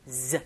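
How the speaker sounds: "z" held as a voiced buzz; drawn-up buzz. Shown as a demonstration. Teacher corrects the Korean j, a sustained buzzed "z". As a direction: pull up a long buzzing "z". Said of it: "끌어올려주시는 발음".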